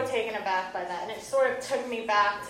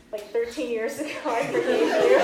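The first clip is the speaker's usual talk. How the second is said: laughing; 7 dB louder than usual. Real, not acted.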